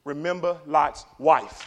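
three words set down slowly; slow and measured. Real, not acted. Preacher gives three words of warning, slow and measured. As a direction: deliver it slowly, word by word. First, measured take before shouted repeat.

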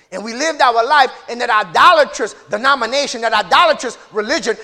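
loud, emphatic testimony from the pulpit; forceful preaching. Real, not acted. Preacher recalling life in idolatrous religion, loud preaching. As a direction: hammer out the denunciation loudly.

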